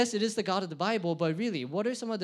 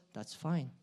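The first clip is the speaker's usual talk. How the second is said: dismissive chill God; 3 semitones lower, 8 dB quieter than usual. Acted. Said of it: "God looks at that and goes".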